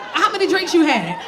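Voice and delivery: normal talking voice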